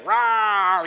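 put-on loud child's rawr; loud playful rawr; playful lion roar. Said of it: "randomly go".

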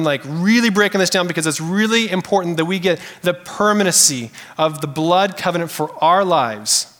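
normal talking voice

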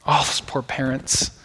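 put-on hushed, slow sympathy for parents of a crying baby; soft compassion. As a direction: sigh it out for the poor kid. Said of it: "like understands, like".

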